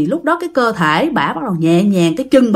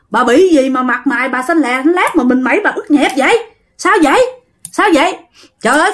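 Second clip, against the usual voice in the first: alarmed volley of higher-pitched questions; rapid-fire questioning; 6 semitones higher than usual. A narrator's reading. Bakers questioning the frightened, soaked woman, urgent rapid-fire questions. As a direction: pelt her with questions, one after another. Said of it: "mấy ổng hỏi dồn dập".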